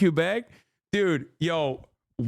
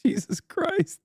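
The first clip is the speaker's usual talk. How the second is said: much higher, laughing gasp of shock; shocked exclamation; slower than usual. Real, not acted. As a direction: blurt it out in shock.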